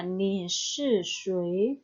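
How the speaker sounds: slow, careful Mandarin model read; slow model sentence. Shown as a demonstration. Teacher reading an example sentence to students, slow and careful. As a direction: spell it out slowly for learners. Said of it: "yang kedua".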